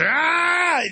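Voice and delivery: high, drawn-out "aah" war cry; mock attack yell. Mimics police charging in.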